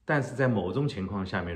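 ordinary talk, nothing marked

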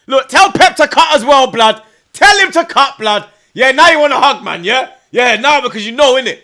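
loud, high jeering at City fans; gloating taunt. Real, not acted. Fan taunting City supporters after the draw, loud gloating jeer. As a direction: rub it in their faces, loud and high.

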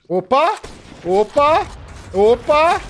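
loud high shouts; real hyped shouting over the play; excited yells. Real, not acted. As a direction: yell it at the screen. Yelling at a big play.

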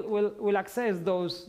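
normal talking voice

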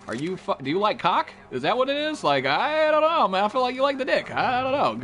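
high, exaggerated questioning of a friend; hammed-up disbelief, climbing high; mock incredulous. Acted incredulous reply to his friend.

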